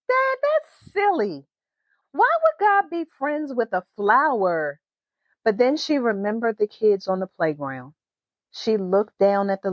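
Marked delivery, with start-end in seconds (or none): 0.1-4.8 put-on high, amused child's voice; girl laughing at her dad's silly question, high pitched; giggly little girl.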